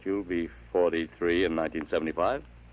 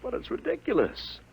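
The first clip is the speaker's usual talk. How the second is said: jumps much higher in disbelief; incredulous. Acted. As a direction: push it away, it can't be. Father rejects time-travel story.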